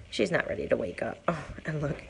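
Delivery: ordinary talk, nothing marked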